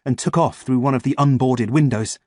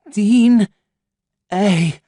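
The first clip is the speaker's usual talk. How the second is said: delirious reply; 10 semitones higher, 7 dB louder than usual. Acted. Delirious answer.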